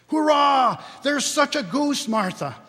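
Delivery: put-on high, loud cheering children; excited children shout. A narrator's reading. Young children tell their sister about the goose, shouting high. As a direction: cheer like kids who smelt dinner. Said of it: "cried the two young Cratchits".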